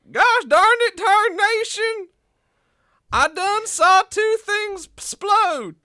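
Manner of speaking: high, slow hillbilly farmer voice; twangy old-timer yelling; old prospector. Acted. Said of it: "walk in and be like".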